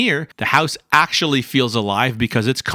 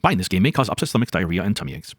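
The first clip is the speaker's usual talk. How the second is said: fast disclaimer; 8 semitones lower, much faster than usual. Pretend. Mock ad side-effect disclaimer.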